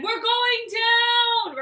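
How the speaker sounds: slow, high dramatic inner voice. Retold or imitated. To herself mid-fall, slow high doom voice. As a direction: drag it out like doom announced.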